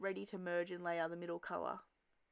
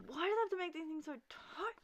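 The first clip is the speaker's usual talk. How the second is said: high-pitched frustrated whine; exasperated complaint; 10 semitones higher than usual. Acted. Injured tagger wrestling with tight jeans, exasperated. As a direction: struggle and grumble, pitch way up.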